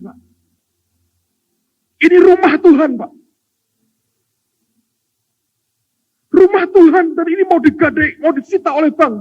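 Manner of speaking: high, loud, begging church member; pleading debtor. Retold or imitated. Indebted man begging the pastor to pray, high loud pleading. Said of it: "Datang ke pendeta".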